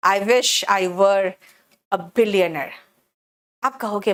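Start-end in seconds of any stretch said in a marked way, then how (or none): 0.1-2.7 slow, deliberate dictation pace; slow model sentence. Shown as a demonstration. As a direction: read it out slowly for the class. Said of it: "काश मैं अरबपति होती।". (धीमी गति से, साफ़-साफ़ बोलकर)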